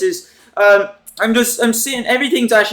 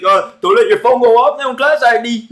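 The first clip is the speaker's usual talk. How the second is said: imitating a taunting classmate, pitched up; higher-pitched schoolyard ribbing; mock teasing; 3 semitones higher than usual. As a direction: needle him in a higher put-on voice. Classmate teasing him in a story.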